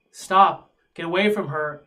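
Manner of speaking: protective yell, loud on the first word. Acted. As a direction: cry out to fend off an attacker. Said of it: "you see her yell out".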